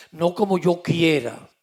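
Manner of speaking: loud, fervent pulpit voice; fervent preaching. Acted. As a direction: thunder the message to the congregation. Preaching at full force. (predicando con fuerza desde el púlpito)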